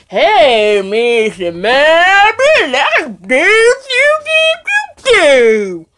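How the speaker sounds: loud, wailing minstrel-show parody with swooping pitch; mocking minstrel caricature. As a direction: belt out a hammed-up, drawn-out minstrel mockery. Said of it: "doing their shiftless".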